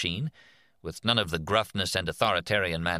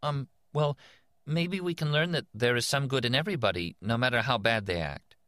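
put-on higher, hesitant little girl; small girl voice; 7 semitones higher than usual. A narrator's reading. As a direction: answer shyly like a small girl. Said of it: "A small girl's voice".

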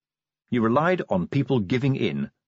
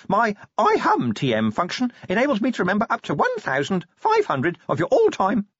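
much higher imp rattling off offers; higher pleading imp; desperate salesy imp; 12 semitones higher than usual. Acted. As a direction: keep pitching before the lid closes. Imp keeps pitching features.

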